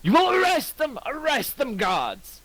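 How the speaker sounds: high furious demand that fades at the end; shouting in rage. Acted. Lucius shouting at the guards, high enraged. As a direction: bellow at the guards. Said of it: "continue to shout".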